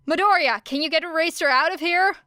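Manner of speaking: urgent call. A narrator's reading. Calling out mid-battle.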